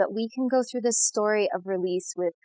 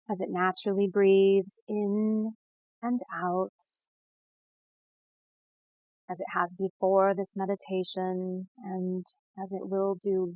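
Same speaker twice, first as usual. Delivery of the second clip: guided meditation; 4 dB quieter than usual. Real, not acted. Guide leading listeners to settle and breathe, soothing meditation voice. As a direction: ease the listener gently into calm, unhurried.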